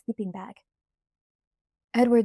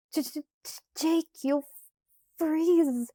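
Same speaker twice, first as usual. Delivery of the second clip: teeth chattering, stammering high; shivering stutter; 8 semitones higher than usual. A narrator's reading. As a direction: stutter through the cold. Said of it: "I tried to complain".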